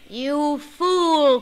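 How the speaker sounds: scornful loud snap; angry stepmother. Acted. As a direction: scold the boy. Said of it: "cried the stepmother".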